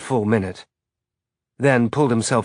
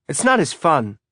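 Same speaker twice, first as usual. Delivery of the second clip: verbal shrug; 6 semitones higher than usual. Acted. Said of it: "again with the verbal shrug".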